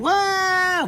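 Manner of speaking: high, drawn-out whooping scream; reenacted high-pitched yell. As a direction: scream it like hanging on for the ride.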